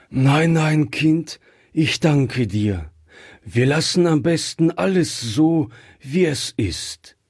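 plain reading voice

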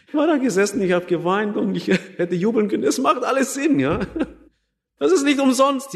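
high, breaking into joyful laughter; laughing with joy. Real, not acted. Preacher recalling his conversion, laughing with joy. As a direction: laugh through the words, overjoyed. Retelling joy at finding faith.

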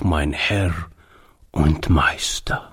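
slow, hushed and awed; a low reverent whisper. A narrator's reading. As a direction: whisper the word as if sacred.

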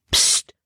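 sharp voiceless "psst"; warning hush. A narrator's reading. Quick warning from above to Libby, a hushing hiss. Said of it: "kam die schnelle Warnung von oben".

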